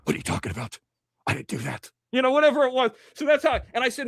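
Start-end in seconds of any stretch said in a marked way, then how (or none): 0.0-1.9 very low, hushed movie tough guy; Stallone impression. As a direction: drop way down and soft, action-hero style. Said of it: "I saw Stallone the other night".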